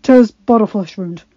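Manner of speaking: brushing off pain, wincing. A narrator's reading. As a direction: wince through it. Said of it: "wincing slightly".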